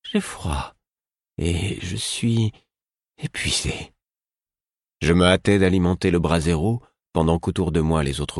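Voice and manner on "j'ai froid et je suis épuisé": pitiful. Acted. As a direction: sound drained and cold. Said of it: "d'un ton pitoyable".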